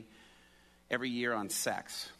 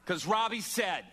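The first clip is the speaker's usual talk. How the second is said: higher, half-laughing mock proclamation; acted declaration; 8 semitones higher, 4 dB louder than usual. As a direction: announce it high, citing an authority. Girlfriend's proud announcement, acted.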